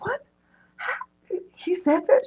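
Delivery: high, breathless disbelief; mock shock. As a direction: imitate a stunned friend squeaking questions. Retelling Brittany's shocked reaction.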